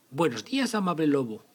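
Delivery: put-on high, bright girl greeting; little girl voice. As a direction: greet the wolf like a sweet child. Caperucita greets the wolf.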